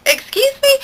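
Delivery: high, loud, offended disbelief; squeaked offended excuse me; shocked excuse me. Retold or imitated. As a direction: rise in pitch at the insult. Shocked retort to insult.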